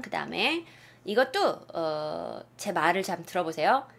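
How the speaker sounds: normal talking voice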